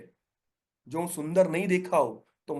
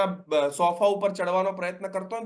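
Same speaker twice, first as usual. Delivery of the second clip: usual speaking voice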